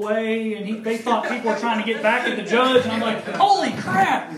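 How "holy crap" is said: high, loud laughing exclamation; shocked. Retold or imitated. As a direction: blurt it out in shock. Shocked reaction.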